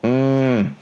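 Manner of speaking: ordinary talk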